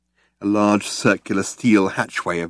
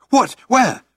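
startled high What Where; alarmed cry; 4 dB louder than usual. A narrator's reading. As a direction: jump at it in alarm. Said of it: "cried Arthur".

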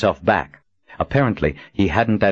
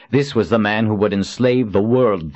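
usual speaking voice

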